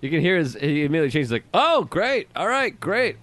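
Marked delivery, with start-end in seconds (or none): 1.5-3.2 perked up. Retold or imitated. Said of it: "He started pepping up".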